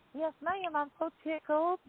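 light Southern imitation, playful; imitating Southern accent. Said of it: "I love her southern accent".

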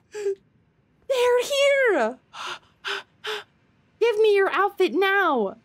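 high, excited squeal; breathless high-pitched excited demand; excited cry. Real, not acted. Game trailer moment, excited demand.

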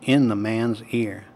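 ordinary delivery

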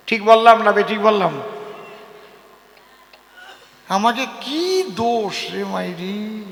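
ordinary talk

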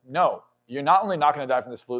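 ordinary talk, nothing marked

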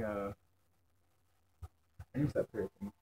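ordinary talk, nothing marked

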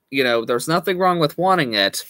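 ordinary talk

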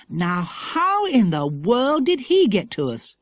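high, drawn-out amazement; astonished cry. A narrator's reading. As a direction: cry out in puzzlement, slowly. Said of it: "cried Philip".

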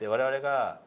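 ordinary talk

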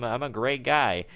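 ordinary delivery

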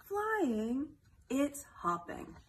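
ordinary talk

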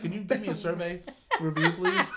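laughing, with please jumping way up; cheeky request. Retold or imitated. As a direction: ask for the review with a giggling, absurd sweetness. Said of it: "I was like".

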